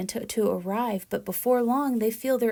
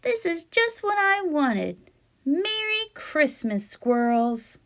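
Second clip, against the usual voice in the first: a squirrel thrilled by the gift, squealing; delighted squirrel; 8 semitones higher than usual. Acted. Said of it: "said Mister Peanuts".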